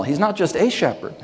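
"a" lifted high in "just a shepherd"; stress on A. Shown as a demonstration. Preacher insisting this is personal, stress on "a". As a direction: pick out "a" to reject it. Contrasts "a" with "my.

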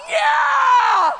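loud high whoop; cheering yell. Retold or imitated. Mom in the stands, whooping loudly. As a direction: cheer like a fan. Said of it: "I'm like".

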